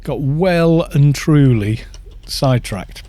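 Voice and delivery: ordinary delivery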